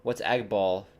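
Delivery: ordinary delivery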